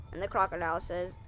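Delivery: ordinary delivery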